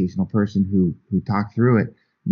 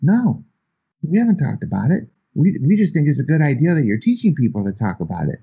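much higher, sheepish confession; sheepish admission; 6 semitones higher than usual. Retold or imitated. Father and daughter admitting they never talked, much higher sheepish. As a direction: own up to it, a bit embarrassed. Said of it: "And they go".

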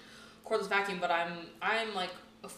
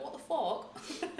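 drawn-out, rising British "fuck"; higher, very slow British-accented swearing; mock British accent; 6 semitones higher, 4 dB quieter, much slower than usual. Shown as a demonstration. Host swearing as she does with friends, exaggerated British accent. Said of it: "Swearing's just more fun in a British accent".

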